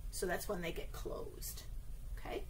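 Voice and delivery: hushed, gentle whisper; soft whisper. Acted. Teacher whispering to the children about closing shops, softly. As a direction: let it drop to a whisper for the kids.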